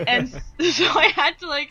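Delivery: chuckling through the start; laughing. Real, not acted. Laughing while recounting the footsteps.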